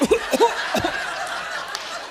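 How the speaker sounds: a quick pointed cough; demo cough. Shown as a demonstration. Showing the women in the audience the cross-legged cough, a deliberate fake cough. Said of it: "cross your legs and cough".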